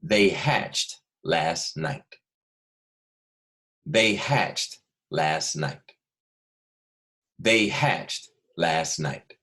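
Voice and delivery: very slow, each word spaced out; dictation pace. Shown as a demonstration. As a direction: dictate clearly for little writers. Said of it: "And your sentence is".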